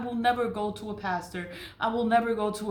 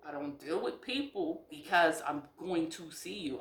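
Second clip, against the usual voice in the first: ordinary talk, nothing marked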